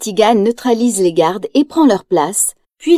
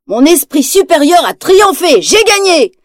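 much higher, louder, crowing victory; smug gloating; 6 semitones higher, 4 dB louder than usual. Acted. Ratigan gloating over the captured detective, smug crowing. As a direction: gloat like a villain who thinks he has won. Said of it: "dit le rat d'un air suffisant".

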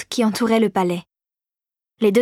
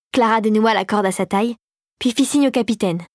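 plain reading voice

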